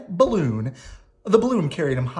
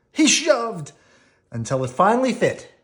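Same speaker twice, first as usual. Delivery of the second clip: ordinary delivery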